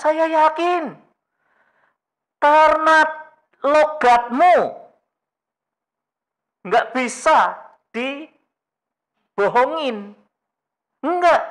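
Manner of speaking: high, loud, desperate denial; panicked insisting disciple; Peter's frantic denial. Retold or imitated. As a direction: deny it hard as the cornered disciple. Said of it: "Simon Petrus menjawab apa?".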